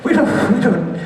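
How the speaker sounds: laughing, high and squeaky; words squeezed out through a laugh. Real, not acted. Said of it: "laughs while saying it".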